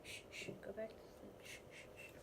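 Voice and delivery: soft fast shushing whisper; whispering over a crib. Real, not acted. Shushing baby to sleep.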